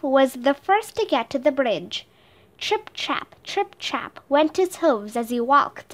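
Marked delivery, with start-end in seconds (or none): 2.6-3.3 light hoof taps. A narrator's reading. Small goat hooves sound effect.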